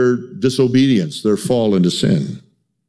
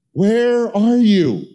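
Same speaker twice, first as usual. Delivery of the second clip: higher, slow pleading call; God's longing call; 9 semitones higher, much slower than usual. Retold or imitated. Preacher voicing God to the congregation, high slow plea. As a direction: stretch it out like a father calling a lost child. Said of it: "Listen to it".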